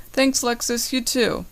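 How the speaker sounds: usual speaking voice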